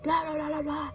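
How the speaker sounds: high, exasperated inner voice; frustrated self-quote. Retold or imitated. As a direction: vent like your younger self stuck again. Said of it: "I'm like".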